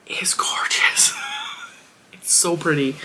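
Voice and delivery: breathy whisper of delight; whispered awe. Real, not acted. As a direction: whisper it like a treasure. Admiring the cord.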